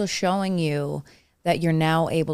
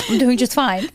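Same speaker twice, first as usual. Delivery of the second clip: high, louder forced cheer while crying; forced cheerful fib while crying; mock-brave through tears; 7 semitones higher, 6 dB louder than usual. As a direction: insist you're fine, voice up high and loud. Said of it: "I was, like, really crying".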